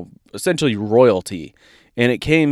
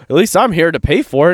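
ordinary talk, nothing marked

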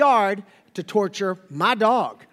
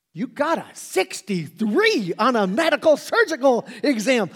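higher, louder, playfully amazed; mock astonished; 5 semitones higher, 4 dB louder than usual. Father to crying daughter about her exam, mock amazed. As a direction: act dazzled by the score. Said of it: "I was like".

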